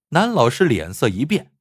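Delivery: plain reading voice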